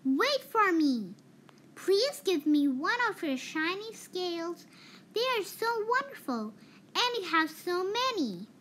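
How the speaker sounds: high, eager pleading little fish. A narrator's reading. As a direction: beg sweetly for a shiny scale. Little fish begging for scale.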